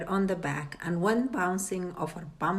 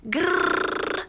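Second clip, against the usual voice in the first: angry growl; 11 semitones higher than usual. A narrator's reading. The fish growling at the father, angry growl. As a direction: growl in frustration.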